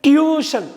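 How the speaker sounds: "டியூஷன்" as "tyoo-shun", high first syllable; correct pronunciation. Shown as a demonstration. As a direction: pitch up "டியூஷன்" with a y-glide after t. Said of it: "சொல்லுங்க".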